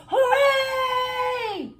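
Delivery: high, ringing cheering hooray; crowd cheer. A narrator's reading. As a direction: shout it like a whole crowd.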